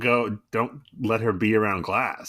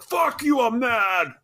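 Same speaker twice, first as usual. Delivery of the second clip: mock angry shout; 13 semitones higher, slower than usual. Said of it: "And then Clark's like".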